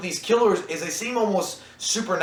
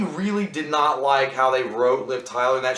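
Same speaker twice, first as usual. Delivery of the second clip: normal talking voice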